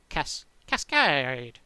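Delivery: "cascade" drawn out slowly and falling; falling inflection. Shown as a demonstration. Demonstrating a true cascade, slow falling Cascade. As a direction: let "cascade" slide slowly downward. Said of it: "I'd have to go down in inflection".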